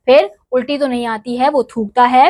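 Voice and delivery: ordinary talk, nothing marked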